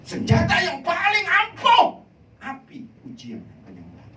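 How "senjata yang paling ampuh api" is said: high, loud preacher's cry; loud, high-pitched sermon climax; fiery preaching. Acted. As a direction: thunder it at the congregation. Preaching at full force.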